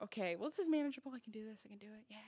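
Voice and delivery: quick, fading pep talk to oneself; self-reassurance. Retold or imitated. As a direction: talk yourself round, trailing very soft. Reassuring herself after diagnosis.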